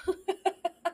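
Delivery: high, soft giggle at own mistake; quick, quiet, high chuckle; amused laugh. Real, not acted. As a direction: giggle helplessly at your slip. Catches own mix-up of names.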